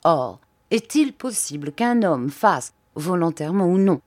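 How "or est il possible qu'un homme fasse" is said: plain reading voice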